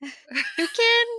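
squawked high and loud; toucan call. Pretend.

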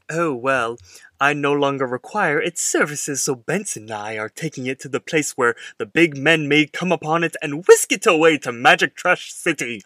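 a hint of an eccentric character voice; Pops. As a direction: quote it in a mildly weird, old-fashioned flourish. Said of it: "but in a Pops way".

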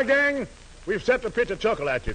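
normal talking voice

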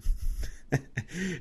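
breathy, voiceless chuckle; short laugh. Real, not acted. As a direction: let out a quick wheezy laugh. Laughs at own explanation.

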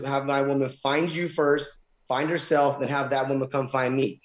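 usual speaking voice

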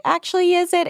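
high, sarcastic question; mock skeptical question. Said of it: "the New York Times is like".

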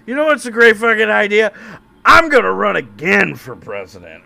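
loud, high, cocky pub boast; drunk boast. Retold or imitated. Drunk president boasting to the pub. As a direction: brag it like a drunk at the bar.

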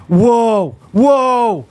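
put-on high, drawn-out toddler whoa; Bo's whoa. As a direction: imitate a stuck toddler's long whoa. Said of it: "and he's just like".

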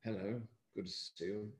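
lightly voiced posh British gent; a hint of a genteel old English actor; Peter O'Toole. Actor showing his prince idea to the interviewer, lightly posh British. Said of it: "he was like Peter O'Toole".

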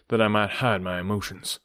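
usual speaking voice

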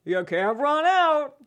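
Toy Story Mania quote. Shown as a demonstration. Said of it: "That's from Toy Story Mania".

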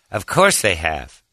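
lightly voiced, slow self-satisfied reply; Jon Lovitz voice. Lovitz answers a fan, lightly pompous impression. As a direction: say it slowly, lightly imitating a pompous star. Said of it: "In that voice".

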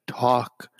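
demanding, nagging gossip prod; mild Joan Rivers nag; Joan Rivers line. Retold or imitated. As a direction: coax them to spill it. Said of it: "as Joan, Joan Rivers would say".

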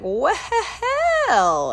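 high, laughing quoted outburst; drawn-out, high laughing exclamation; hooting laugh. Retold or imitated. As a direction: laugh right through the word, high-pitched. Acted quote, cackled exclamation.

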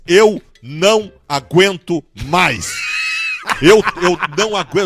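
slow, loud, drawn-out cry of being fed up; half-joking wail of exhaustion, shouted slowly; exasperated outcry. Pretend. Mock plea to podcast hosts.

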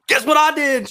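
eager high bragging question; excited. Retold or imitated. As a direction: ask it like a proud kid. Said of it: "I was like".

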